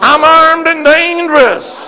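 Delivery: loud, high, slow call-out; rousing declaration. Real, not acted. Preacher leading the church to repeat after him, rousing declaration. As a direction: proclaim it slowly for the crowd to echo.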